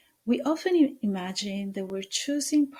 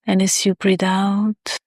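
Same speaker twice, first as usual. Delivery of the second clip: guided meditation; 11 dB louder than usual. Acted.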